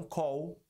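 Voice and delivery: "call" ending like "cou". Shown as a demonstration. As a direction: glide "call" off into W. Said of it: "como muita gente fala".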